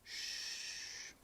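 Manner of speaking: soft whispered shush; hushing. A narrator's reading. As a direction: hush her gently. Princess hushes the girl.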